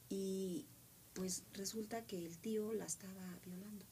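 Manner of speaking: usual speaking voice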